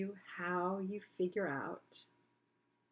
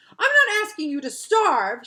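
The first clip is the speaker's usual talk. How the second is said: loud, higher irritated protest; an exasperated child, loud and high; irritable; 10 semitones higher, 15 dB louder than usual. Acted. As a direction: snap it out in irritation. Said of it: "she said irritably".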